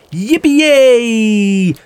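high, joyful yippie shout; a fawn whooping for joy; gleeful cry. Acted. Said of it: "roept Bambi".

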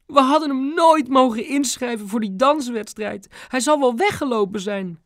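high tearful mother voice. A narrator's reading. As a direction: keep crying through the blame. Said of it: "voegde mam er snikkend aan toe".

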